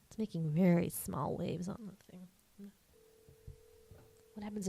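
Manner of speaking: soft unvoiced whisper. Real, not acted. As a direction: whisper so the phone won't hear. Waiting quietly for the call.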